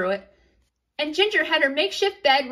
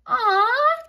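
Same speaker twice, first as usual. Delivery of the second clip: much higher, louder fond aw; touched aw; 10 semitones higher, 4 dB louder than usual. Acted. Twin coos at little sister wanting her brother, high and fond. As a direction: melt at the cute moment.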